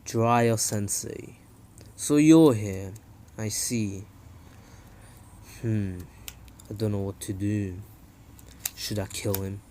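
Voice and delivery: low, quiet, menacing villain voice; low, soft voice for Pain facing Jiraiya; Pain's voice. Retold or imitated. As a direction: brood coldly in a low murmur. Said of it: "and Pain says".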